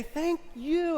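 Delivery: hammed-up thanks; high mock thank you. Shown as a demonstration. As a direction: play it up like a diva. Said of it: "And you say".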